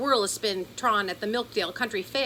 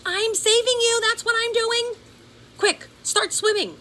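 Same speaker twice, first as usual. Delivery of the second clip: urgent shouting; 8 dB louder than usual. Acted. Boy and girl shouting in danger.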